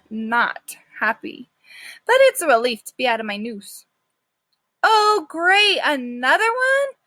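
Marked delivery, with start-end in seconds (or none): 4.8-7.0 higher, louder exasperated woman; weary worker call. Acted. The shelter worker calls out at the new dog, high and exasperated. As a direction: call out, fed up. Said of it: "calls a woman".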